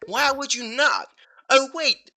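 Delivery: ordinary talk, nothing marked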